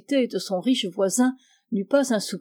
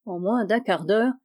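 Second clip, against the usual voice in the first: ordinary delivery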